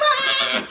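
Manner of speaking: high-pitched mock scream; JoBeth Williams. Imitating the mother racing to her babies, high scream. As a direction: shriek like a horror-film mother running. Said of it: "She's like".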